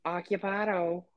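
high, silly put-on voice; jokey put-on call. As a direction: call it out as a cartoonish joke. Jokey put-on bathroom reply.

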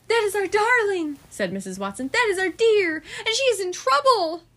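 hearing her darling in trouble, raise it high; alarmed mother. A narrator's reading. Missus Watson crying out to her husband about their pig.

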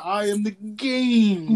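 slow, drawn-out mock wrestler growl; Triple H. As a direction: stretch every word like a hammed-up wrestling promo. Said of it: "I never knew Triple H talked like that".

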